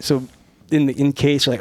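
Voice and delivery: ordinary delivery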